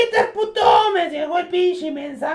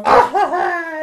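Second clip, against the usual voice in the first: villain laugh; 3 semitones higher, 8 dB louder than usual. Acted. Joker laughs on the phone.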